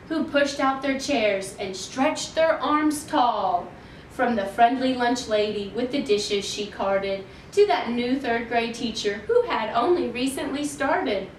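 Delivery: usual speaking voice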